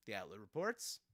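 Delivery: ordinary delivery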